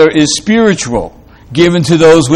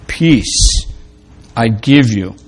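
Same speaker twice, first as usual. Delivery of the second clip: quiet, fading voice of God giving peace; gentle divine voice; 4 semitones lower, 4 dB quieter than usual. Acted. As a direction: let it sink to a hush as you bless them. God speaking peace to listener.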